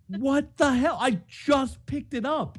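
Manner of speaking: high, incredulous what the hell; exasperated outburst. Retold or imitated. To the phone after pickup, high exasperated disbelief. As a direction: voice jumps up in disbelief.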